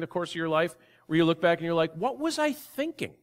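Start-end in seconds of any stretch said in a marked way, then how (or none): 1.9-3.1 jumps much higher in disbelief; bewildered exclaim. Retold or imitated. Preacher voicing a listener's past regret, incredulous and high. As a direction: squeak it out, baffled at yourself. Imitating bewildered self looking back.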